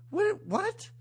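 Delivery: high baffled repeated what; mock confusion. Viewer baffled by the movie, high and confused. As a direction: squeak out a puzzled what. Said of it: "I'm still watching this going".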